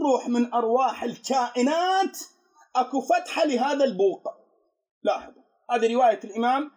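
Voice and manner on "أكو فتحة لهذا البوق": ordinary talk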